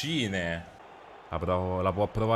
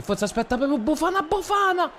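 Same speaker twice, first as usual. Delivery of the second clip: much higher, squeaky play-by-play; Mickey Mouse voice; faster than usual. Acted. Streamer commentating his game in a squeaky Mickey Mouse voice. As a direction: call the match in a cartoon mouse squeak. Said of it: "voce alla Topolino".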